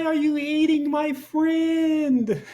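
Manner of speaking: very high, slow mock-sobbing kid; crying child. Three-year-old crying at the family dinner, very high and slow. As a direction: plead through fake toddler tears. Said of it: "I started crying".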